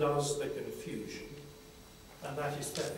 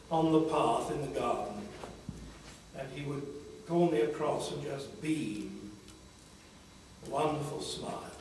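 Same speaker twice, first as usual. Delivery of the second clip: ordinary delivery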